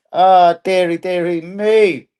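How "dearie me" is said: ordinary delivery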